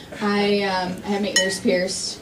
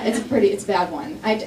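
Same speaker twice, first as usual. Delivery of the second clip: usual speaking voice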